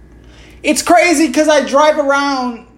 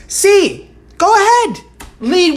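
daring taunt; 7 semitones higher, slower than usual. Real, not acted. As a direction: challenge him to try it. Daring the president, rising.

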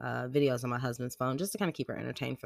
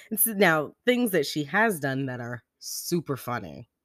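ordinary talk

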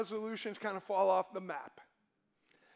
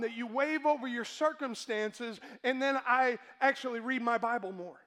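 louder, driving preacher cadence; preaching; 3 semitones higher, 4 dB louder than usual. Acted. Pastor driving home priorities to the congregation, preaching. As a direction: preach it hard to the room. Preaching builds to full force.

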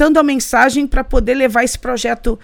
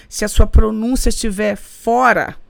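ordinary talk

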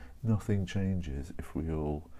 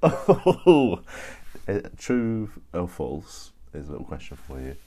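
sudden excitement; 3 semitones higher, 9 dB louder than usual. Real, not acted. Jumps into quiz question.

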